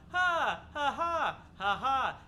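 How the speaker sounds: loud, high forced "ha ha ha" to sell a bad joke; fake laugh at own joke. Comedian laughing at his own flop joke, loud hammy fake laugh. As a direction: laugh it up falsely, hammed-up. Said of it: "You see why I didn't do the jokes?".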